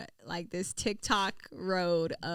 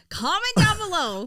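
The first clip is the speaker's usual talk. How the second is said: high, loud playful call-out; rally the viewers, half joking; mock audience appeal; 9 semitones higher, 6 dB louder than usual. Host asking viewers to back her up, mock call-out.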